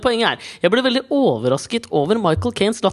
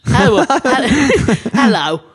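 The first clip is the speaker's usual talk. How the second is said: very slow, louder drawn-out Michael Caine drawl; Michael Caine impression; 3 semitones higher, 4 dB louder, much slower than usual. Showing his co-host how the actor really sounds, slow drawl. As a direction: stretch the hello out like the old English actor. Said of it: "Michael Caine er mer sånn her".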